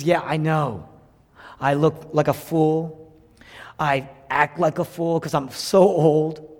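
slow, drawn-out confession, lightly acted; old man on his deathbed. Dying Jacob admitting foolishness to Joseph, lightly voiced old man. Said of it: "He's in his deathbed".

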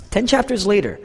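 usual speaking voice

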